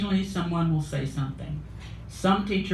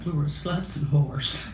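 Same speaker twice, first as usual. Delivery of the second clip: ordinary delivery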